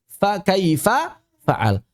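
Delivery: very slow "kaifa fa'al", both fa syllables separate; bacaan benar. Shown as a demonstration. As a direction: stretch out "kaifa", keeping its last vowel. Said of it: "Enggak dibaca alam tara kaifa fa'al". (lafalkan "kaifa fa'al" perlahan, fa tidak dilebur)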